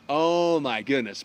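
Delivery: slow, stretched-out dismay; drawn-out dread. Acted. Lancelot facing his wedding night, slow mock dread. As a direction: let the dismay sink in, dragging the words.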